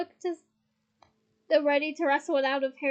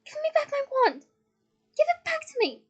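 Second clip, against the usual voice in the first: high, loud, frantic fury; out-of-control demand; 12 semitones higher, 8 dB louder than usual. A narrator's reading. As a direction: demand it back at the top of your voice. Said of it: "lose control like this".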